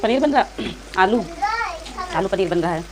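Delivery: ordinary talk, nothing marked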